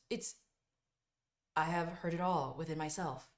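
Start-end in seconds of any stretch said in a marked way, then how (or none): none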